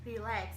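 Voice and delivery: "relax" with the second syllable stressed. Shown as a demonstration. Teacher showing verb stress to learners, "relax" stressed on its second syllable. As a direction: lean on LAX in "relax". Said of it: "on the second syllable".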